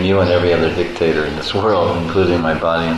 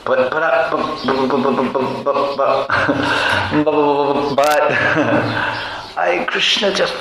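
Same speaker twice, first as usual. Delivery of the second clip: higher, stammering plea for a little more; 6 semitones higher than usual. Acted. Devotee begging Krishna, higher and stammering. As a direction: beg timidly, tripping over but.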